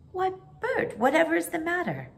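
surprised. Acted. Mary startled by excited Bert.

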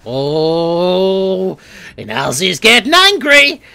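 high, loud announcer voice; hyped commentator calling out his own rage; mock commentator. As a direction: call it like a sports caster. Narrating himself in third person.

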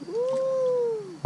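high whoop of delight; excited whoop. Real, not acted. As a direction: cheer at the catch. Fish on the line.

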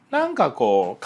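usual speaking voice

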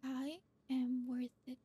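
slow, deliberate I am worth it; said like you mean it. Shown as a demonstration. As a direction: lean on each word as if you mean it. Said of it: "say it like you mean it".

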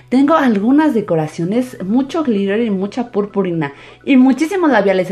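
put-on high, loud kid-show welcome; cheerful children's host greeting; bright squeaky sing-song welcome. Kids channel intro greeting.

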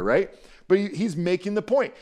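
ordinary delivery

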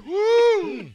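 high loud excited woo; high whoop. Real, not acted.

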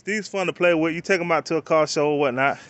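normal talking voice

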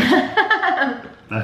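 said through a laugh; laughing while complaining. Real, not acted. Laughing after the sound.

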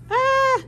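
very high, loud yelp; startled scream. Acted. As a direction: cry out as you're blown away. Wolf blown away by dragon.

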